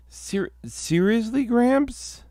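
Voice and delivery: high, disbelieving seriously; incredulous. Acted. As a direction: squeak it out in disbelief. Incredulous at Gramps.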